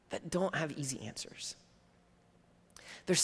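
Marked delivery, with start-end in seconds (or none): 0.1-1.6 soft whisper. Real, not acted. Preacher to congregation about hard questions, in a whisper.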